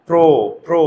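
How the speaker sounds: প্রো said with the jaw kept closed, rounded o; jaw-closed pronunciation. Shown as a demonstration. Teacher showing the class the o sound in প্রো, jaw closed. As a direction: keep the jaw shut on প্রো. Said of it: "চোয়াল খোলে না".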